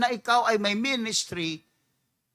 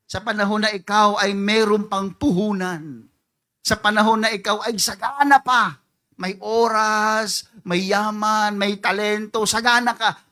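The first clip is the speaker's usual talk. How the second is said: louder and louder, piling up the list; building emphasis; 7 dB louder than usual. Real, not acted. Preacher warning the church about prosperity, louder. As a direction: hammer each blessing home.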